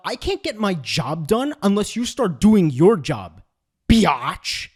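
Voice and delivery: slow, pointed mock demand with a drawn-out insult; hostile demand. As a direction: exaggerate the passive-aggression into open contempt. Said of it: "what she perceived that as was".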